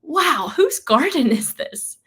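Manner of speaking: high-pitched, slow wonder; awestruck. Retold or imitated. Dreamer seeing the incredible garden, awestruck. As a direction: marvel aloud, drawing the words out high. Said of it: "I was so in awe".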